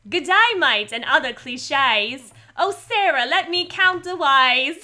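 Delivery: slow, broad Australian drifting to stage Cockney; Australian accent slipping into My Fair Lady. Acted. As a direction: belt out a botched Australian accent. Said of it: "I turned into My Fair Lady really quickly".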